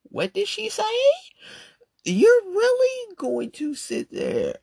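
very high, slow outraged disbelief; shocked at a friend's anger; incredulous. Acted. Incredulous rant.

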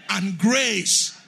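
very loud fervent prayer; shouted prayer. Real, not acted. Pastor leading the church in shouted prayer, very loud. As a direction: shout it with all your strength. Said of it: "Somebody shout".

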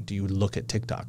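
put-on quiet, low old-person take. Shown as a demonstration. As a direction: ask it like a frail old man. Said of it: "I said that like an old person".

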